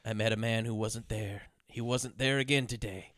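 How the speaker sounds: low, hushed, eerie recitation; spooky voice. Retold or imitated. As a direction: read it like a creepy child in the dark. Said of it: "reads it in a spooky voice".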